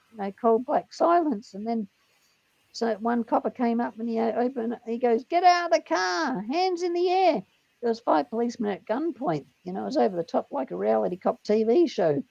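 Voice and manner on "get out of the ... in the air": high, drawn-out called command; mock police order. Copper orders driver from car, high mock holler. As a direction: holler it like a cop at a suspect.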